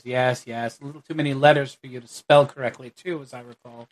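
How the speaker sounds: soft, quick dry dig; father needling the guildmaster, mildly sarcastic; dry eye-roll. Acted. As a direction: roll your eyes and needle him. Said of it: "rolls his eyes".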